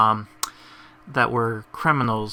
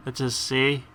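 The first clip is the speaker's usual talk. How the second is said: ordinary delivery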